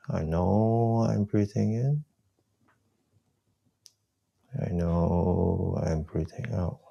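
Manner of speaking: whispered self-talk. Shown as a demonstration. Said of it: "Whisper to yourself".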